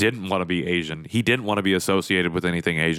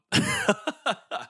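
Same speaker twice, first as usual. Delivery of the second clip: high, soft release of a laugh after tears; teary laugh; 3 dB quieter than usual. Real, not acted. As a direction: laugh out the leftover emotion. Laugh after emotional moment.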